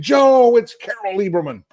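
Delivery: loud, high holler on the name, easing off; re-enacted shouting. Host re-enacting yelling at the radio to correct Joe, shouting. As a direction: yell at the radio in mock exasperation. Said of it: "I was shouting at the radio saying".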